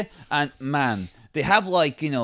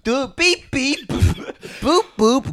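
high playful beeping; mock horn sounds; car horn noises; 9 semitones higher, much slower than usual.

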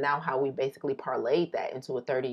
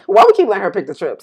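mock complaint; 8 semitones higher, 17 dB louder, much faster than usual. Mock complaint about friend.

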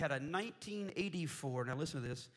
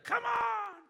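loud, high holler out the car window; impatient yell; 7 dB louder than usual. Retold or imitated. Brother-in-law honking for the ladies, impatient yell. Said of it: "and he yells out".

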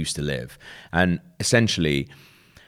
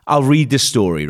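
higher, louder, mildly annoyed; retold annoyance; 6 semitones higher, 6 dB louder than usual. Annoyed journalist answering his colleague, retold annoyance. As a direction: retell giving in with a touch of irritation. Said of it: "getting more and more annoyed".

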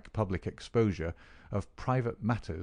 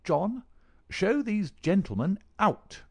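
much higher, slow, furious order; curt furious command to butler; 12 semitones higher, 4 dB louder, slower than usual. Acted. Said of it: "He rang the bell furiously".

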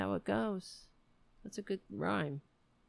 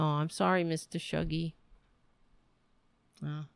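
ordinary talk, nothing marked

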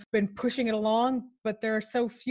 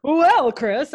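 radio host voice; 4 semitones higher, 7 dB louder than usual. Pretend. Continues the mock radio banter.

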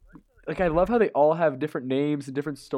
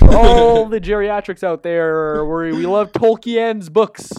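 slow, loud, higher over-enunciation; talking to the hard of hearing; 6 semitones higher, 10 dB louder, slower than usual. Pretend. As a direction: speak up slowly for old listeners. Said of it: "for our hard of hearing viewers".

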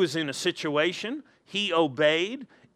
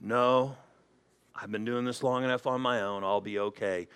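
low, soft, mildly stubborn brush-off; dismissive driver; 6 semitones lower, 4 dB quieter than usual. Acted. Pastor mimics driver refusing help, mildly dismissive. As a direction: quietly wave the help away.

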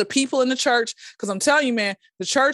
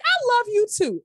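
mock sweet reply; 11 semitones higher than usual. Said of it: "I'm like".

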